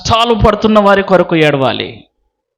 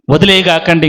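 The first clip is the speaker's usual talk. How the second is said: usual speaking voice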